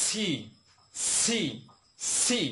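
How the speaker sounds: "সি" drilled with a strong, lengthened s; correct letter C. Shown as a demonstration. Teacher drills correct pronunciation, "সি" with a long sibilant. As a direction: push air through the s of "সি". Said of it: "correct pronunciation হবে".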